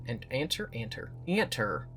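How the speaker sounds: southern accent. Shown as a demonstration. Mock southern accent on Enter, playing with the word. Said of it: "talking in a southern accent now".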